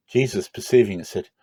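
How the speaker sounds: plain reading voice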